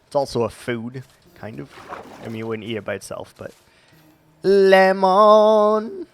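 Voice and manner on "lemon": "lemon" said high and loud with a rising tune, as "læmɑn"; funny pronunciation. Shown as a demonstration. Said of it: "because you can say it like lemon".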